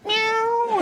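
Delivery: a tiny, high kitten meow; playful meow. Retold or imitated. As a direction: mew like a sneaky little cat. Daughter meows at dad.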